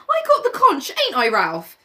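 put-on a boy insisting on his turn, half laughing; high whiny boy pleading with Ralph; Piggy voice. Piggy voice held.

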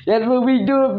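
teasing pitch-up. Pretend. Repeated higher teasing.

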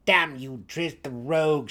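loud, spat curse. A narrator's reading. Brisa curses her brother, spitting fury. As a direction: spit the words with contempt. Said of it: "she spat".

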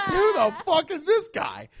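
high, loud, drawn-out disbelief; exaggerated incredulous outburst; mock bafflement. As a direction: squeal it as a baffled viewer. Acts baffled viewer's reaction.

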